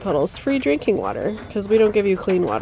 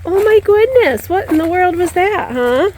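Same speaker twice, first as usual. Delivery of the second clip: wide-eyed amazement, slowed down; startled high call; 10 semitones higher, 6 dB louder than usual. Real, not acted. Startled after a loud boom.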